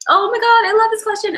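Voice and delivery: high, loud squeal of delight; excited. Real, not acted. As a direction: gush over the question. Excited reaction to a question.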